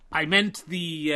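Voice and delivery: ordinary talk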